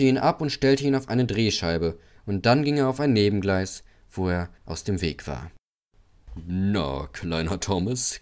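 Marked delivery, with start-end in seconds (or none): 6.5-8.2 low, smug tease; chuckling. A narrator's reading. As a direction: gloat gently over the little one. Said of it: "kicherte Gordon".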